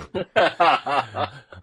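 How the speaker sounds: loud burst of laughter; hearty laugh. Real, not acted. Laughs at fantasy mishap.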